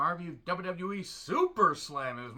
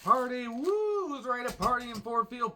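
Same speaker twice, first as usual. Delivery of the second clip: rowdy party animal; 9 semitones higher, 7 dB louder, slower than usual. Pretend. Mock party hype opener.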